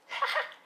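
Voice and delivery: very high quick giggle; squeaky gleeful ha-ha; short villain cackle. Acted. Gremlin offering a pumpkin bomb, short crazed cackle.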